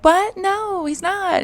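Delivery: high squeaky gasp of disbelief, then trailing off; high-pitched shocked denial. Retold or imitated. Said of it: "And she's like".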